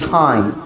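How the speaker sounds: "টাইম" with an aspirated t; native time. Shown as a demonstration. Teacher showing how native speakers say time. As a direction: puff air on the t of "টাইম". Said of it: "তারা বলছে".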